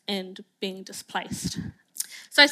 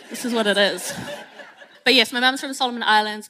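laughing; 2 semitones higher, 7 dB louder than usual. Real, not acted. Laughing after the shark joke.

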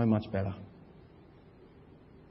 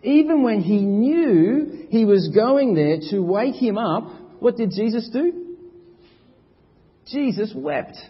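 higher, louder preaching, slowing on the questions; full-force preaching; 8 semitones higher, 5 dB louder than usual. Acted. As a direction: preach with rising urgency.

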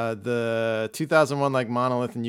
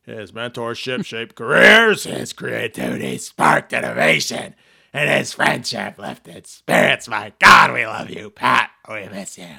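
Vince McMahon impression; 9 dB louder than usual. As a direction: boom it out like a wrestling boss. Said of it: "Vince McMahon tweeted".

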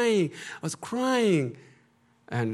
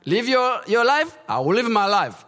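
loud, raised defiant vow; a loud, heated vow of independence; defiant declaration; 5 semitones higher, 7 dB louder than usual. Retold or imitated. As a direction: throw it back at him, high and loud. Retelling defiant words to brother.

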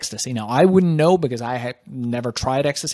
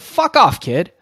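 high, loud brush-off from the dealer; a menacing dealer's loud, high dismissal; retold dealer's snap; 4 semitones higher, 7 dB louder, slower than usual. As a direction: bark him away, much higher and louder. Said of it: "He said".

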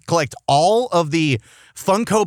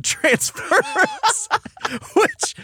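laughing; much slower than usual. Real, not acted.

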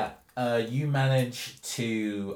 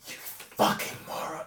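voiceless scolding whisper; hissed whisper. Acted. Stranger grabbing the model's arm in the dark, hissed whisper. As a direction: whisper the insult under your breath.

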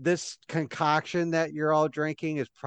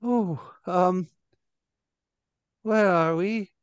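put-on confused old lady; 5 semitones higher than usual.

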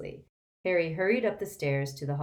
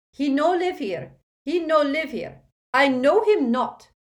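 high, loud panicked broken English; terrified pleading; 11 semitones higher, 11 dB louder than usual. Acted. Woman begging the dark wizard, high and terrified. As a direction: beg him to go away. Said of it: "she cried".

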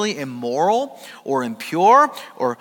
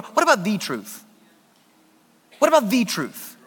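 rhetorical challenge; 4 semitones higher than usual. Acted. Preaching challenge.

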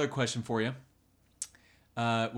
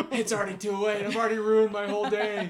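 high, hammed-up wail that the day is ruined; raised, melodramatic self-pity; mock despair; 10 semitones higher, 5 dB louder than usual. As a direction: play the morning like a tragedy. Mock morning despair, retold.

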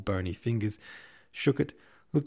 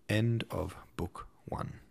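hushed, slow whisper of the closing line; whispered book ending; dramatic whisper; slower than usual. Acted. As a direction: breathe it out like a secret.

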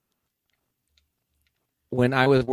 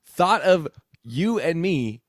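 mock rant; 8 semitones higher than usual.

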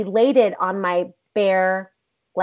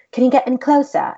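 higher, louder mock British accent; bad British accent; 6 semitones higher, 5 dB louder than usual. Director asking actors for more, in bad British accent. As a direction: put on a clumsy British accent. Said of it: "doing a very horrible job at a British accent".

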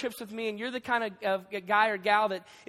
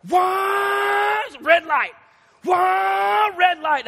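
much higher, louder panicked cry at the red light; desperate shout; 9 semitones higher, 8 dB louder, much slower than usual. Retold or imitated. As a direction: yell in bursting, frantic despair. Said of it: "So I am like".

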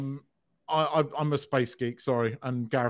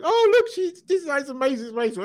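high, gushing impression of a thrilled friend; mock gushing; 13 semitones higher, 5 dB louder than usual. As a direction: gush over Disneyland in a high put-on voice. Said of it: "Len was going".

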